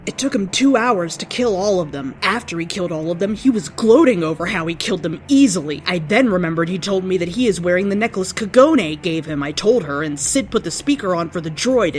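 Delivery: ordinary delivery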